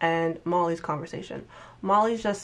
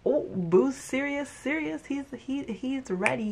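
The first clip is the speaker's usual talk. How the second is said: shook; 7 semitones higher than usual. Retold or imitated. Retelling her reaction to the boyfriend's words, stunned and higher. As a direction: sound floored that he's serious. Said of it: "I was shook".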